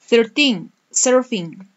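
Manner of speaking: usual speaking voice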